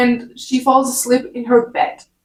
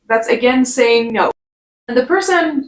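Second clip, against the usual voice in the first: ordinary delivery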